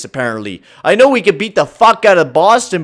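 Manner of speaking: usual speaking voice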